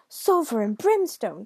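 loud, drawn-out curse; devil's loud outburst; screamed. A narrator's reading. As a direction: yell a slow, swooping oath. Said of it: "screamed the devil".

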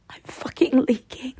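needy whisper. Acted. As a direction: whisper it close.

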